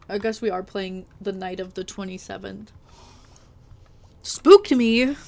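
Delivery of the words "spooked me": startled loud outburst; jump-scared exclamation. Real, not acted. Startled by the date.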